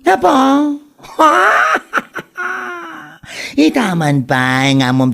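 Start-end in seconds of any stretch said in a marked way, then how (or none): 1.2-2.9 shrieking laugh. Acted. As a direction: let out a piercing, shouted burst of laughter. Friend reacts to kumare's complaint.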